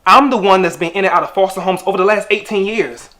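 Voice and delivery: loud, forceful reproach; indignant. Acted. Foster son confronting his birth mother, indignant. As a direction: hurl the grievance at the parent.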